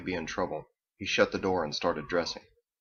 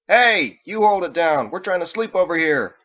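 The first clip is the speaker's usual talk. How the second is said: high, loud complaint through a wall; angry shout; 10 semitones higher, 9 dB louder than usual. A narrator's reading. Neighbour next door wants to sleep, angry shouting. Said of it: "An angry banging came through the wall".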